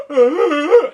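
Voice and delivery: high, exaggerated shuddering gasp; loud startled gasp. Jack looking up at the castle window, big gasp. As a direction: gasp loudly at the sight. Jack sees the crying princess.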